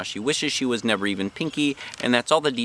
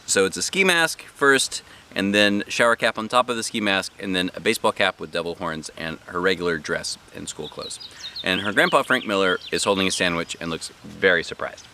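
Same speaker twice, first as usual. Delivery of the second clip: ordinary delivery, nothing marked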